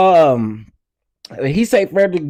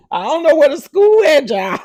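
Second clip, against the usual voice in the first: laughing outburst; 10 semitones higher, 4 dB louder than usual. Real, not acted. Laughing outburst at viewers' question.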